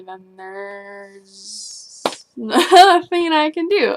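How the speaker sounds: slow, crying through the words; real tears breaking in. Real, not acted. Breaks down over her mom.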